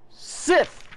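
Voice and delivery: very high put-on voice; silly high-pitched call; odd character cry. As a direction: squawk the name out. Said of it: "I don't know why he sounds".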